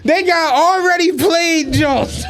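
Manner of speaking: high, laughing "what?"; laughing disbelief. Retold or imitated. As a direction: crack up in disbelief, slowly. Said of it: "And I'm like".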